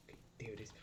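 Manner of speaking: very soft whisper; hushed whisper. Real, not acted. Player spotting the enemy, hushed whisper.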